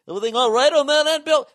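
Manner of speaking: high, loud, fast office voice; chirpy concerned caller; mock concerned colleague. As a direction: coworkers on a conference call checking in, high and bright.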